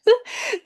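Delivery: short, high-pitched laugh; brief burst of excited laughter; excited giggle. Real, not acted. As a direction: let out a quick high giggle. Laughs while saying she's excited.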